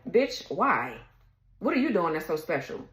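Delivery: usual speaking voice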